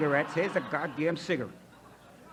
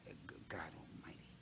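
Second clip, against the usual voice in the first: a breathy, voiceless "God Almighty" under the breath; whispered aside; 9 semitones lower, 21 dB quieter than usual. Real, not acted. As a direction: drop to a whisper, half to yourself. Muttered aside about golf losses.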